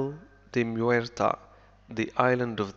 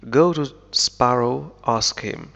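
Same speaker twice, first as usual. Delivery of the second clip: loud, slow call to go; shouted order; 9 dB louder than usual. A narrator's reading. Will shouting at Norrington to go ask Sparrow, loud and slow. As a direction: bark the order across the room, slowly. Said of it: "shouted Will".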